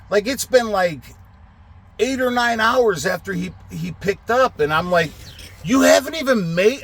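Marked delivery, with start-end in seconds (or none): 5.6-6.8 high, incredulous outburst; retold exasperation, pitched way up; angry disbelief. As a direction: re-enact being outraged at the driver. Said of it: "Now I'm fucking getting angry".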